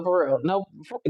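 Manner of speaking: ordinary talk, nothing marked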